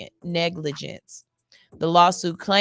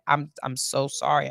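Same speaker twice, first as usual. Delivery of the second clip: ordinary delivery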